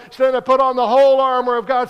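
loud, rousing pulpit call, "whole" ringing out; preacher's exhortation. Retold or imitated. Imitating preachers urging the congregation, loud and rousing. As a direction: belt it like a preacher rallying the flock. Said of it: "We've heard preachers tell us".

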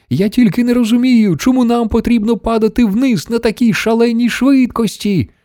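high-pitched, strained calling voice; yelling over noise. A narrator's reading. Nervous Charlie questions Mister Wonka over the noise, high-pitched yell. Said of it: "заволав він, перекрикуючи галас".

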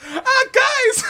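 high cackling mock laugh. Mocking laugh at Batman.

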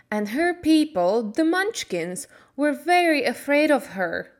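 a hint of a friendly old fairy woman; kindly good witch. Good witch thanking Dorothy, lightly voiced.

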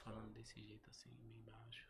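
whispered very softly like the quiet mentor; imitating his whisper. Imitating how T H talked when they met, in a whisper. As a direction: barely breathe it out, mimicking a hushed talker. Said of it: "nesse jeito dele assim, falar assim, desse jeito".

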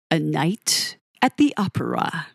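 lightly voiced showman's announcement, dropping low; a hint of a radio DJ unveiling the album; Kenny Everett impression. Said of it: "That was my best Kenny Everett impression".

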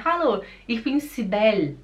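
Cibele in German, ending on the L as si-BEL; near-silent final E. Shown as a demonstration. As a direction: drop almost all the last E of Cibele. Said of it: "o E quase que ele não se". (Cibele em alemão, quase sem o E final)

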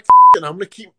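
loud defiant burst; defiant yell. Retold or imitated. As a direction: shout it out, fired up. Deciding to keep fighting.

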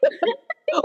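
high-pitched stunned reaction; acted disbelief. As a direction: squeak it out in mock astonishment. Stunned at being picked, retold.

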